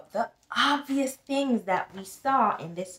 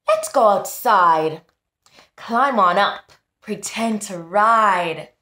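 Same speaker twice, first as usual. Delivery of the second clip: louder, upbeat invitation; cheerful grandma; 9 dB louder than usual. Acted. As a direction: call him out to play. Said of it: "Grandma says".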